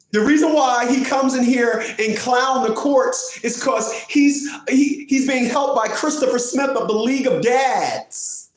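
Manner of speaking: high, drawn-out mocking quote; imitated complainer. Quoting a Rochester opponent blaming League of Dads, high mocking imitation. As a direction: quote it high in mock outrage.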